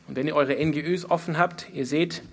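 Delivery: usual speaking voice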